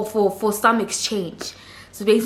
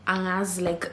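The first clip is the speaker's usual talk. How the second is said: ordinary talk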